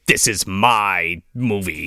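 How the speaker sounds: slow, low mock host voice; slow, ominous host intro; Twilight Zone host. A rival host claiming the movie, slow and ominous. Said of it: "Wait a minute".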